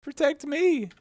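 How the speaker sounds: very high, rising plea, loudest at the end; cried. Acted. Kneeling woman praying for the queen, high desperate cry. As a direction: beg heaven desperately, voice climbing. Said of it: "cried she".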